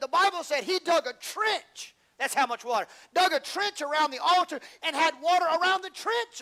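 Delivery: loud, high, rising sermon; preaching at full force. Acted. Preacher building the water scene for the congregation, loud and high. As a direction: shout the story to the church.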